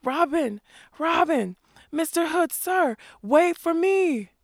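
high, loud calls, slowing at the end; calling after. A narrator's reading. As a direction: shout after the vanishing band, begging. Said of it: "calling hoarsely".

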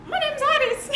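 very high-pitched attempt at a Danish accent; mock Danish accent. Imitating a girl from Denmark introducing herself, exaggerated high accent. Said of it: "she was like".

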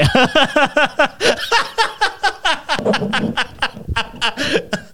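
long high-pitched laugh. Real, not acted. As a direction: laugh hard at your own joke. Laughing at own joke.

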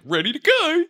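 very high-pitched singsong cheer; gleeful high mock squeal; chirpy excitement. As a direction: chirp it brightly to the family. Retells cheerful message to family.

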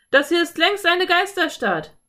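high, loud cowboy holler; bellowed. A narrator's reading. Cowboy to his gang about the town, bellowing. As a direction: shout it out, pitched well up. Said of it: "brüllte einer der Cowboys".

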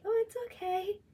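high, sugary fake reassurance; a mock sugary "it's okay"; fake nice. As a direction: play falsely sweet. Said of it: "try to be fake".

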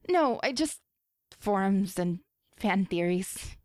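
plain reading voice

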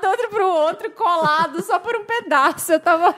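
giggling while telling the embarrassing bit; real laughter in the voice, slowed; laughing. Real, not acted. Laughing at her own date mishap.

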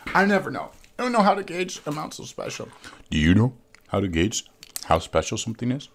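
a hint of a documentary narrator; lightly voiced narrator. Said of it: "I'm just using a narrator voice to do so".

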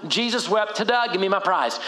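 quick, throwaway recitation for a prize; glib recital. Acted. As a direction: rattle it off, cheekily triumphant. Joking youth group quick recital.

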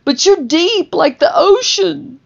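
put-on high pleading voice, baby pool line; ex's confession. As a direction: raise the voice up high for the ex. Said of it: "And she said".

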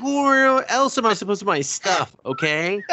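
high, laughing, put-upon little dog voice; defensive dog. Retold or imitated. The dog defending its little backpack, high laughing whine.

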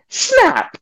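high, stunned echo of the word Snap; shocked echo. Retold or imitated. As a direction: squeak Snap in disbelief. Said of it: "And I".

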